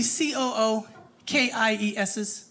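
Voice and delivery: C O O K I E S's spelled letter by letter. Acted. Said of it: "picked up on the spelling of words".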